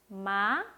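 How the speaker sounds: 마 lightly rising; 마 with a light rise. Shown as a demonstration. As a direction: let 마 glide gently upward. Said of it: "가볍게 올라갔죠?".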